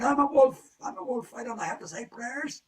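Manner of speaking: high, loud protesting wolf; wolf squealing high and loud in protest. Acted. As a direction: blurt it out as an indignant wolf. Wolf refuses loudly.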